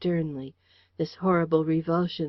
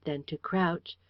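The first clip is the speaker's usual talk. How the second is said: ordinary delivery, nothing marked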